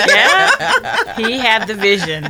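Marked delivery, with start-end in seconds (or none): 0.1-1.2 laughing. Real, not acted. Laughing response.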